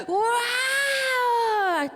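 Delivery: long, high, loud wow through tears; amazed wow. Real, not acted. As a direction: gasp out a drawn-out wow, overwhelmed. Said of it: "Caí redondita". (un guau largo, agudo y fuerte, casi llorando)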